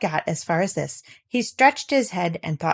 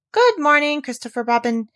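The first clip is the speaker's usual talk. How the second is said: higher, louder friendly call; 7 semitones higher, 4 dB louder than usual. A narrator's reading. Pooh calls out good morning to Christopher Robin. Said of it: "he called out".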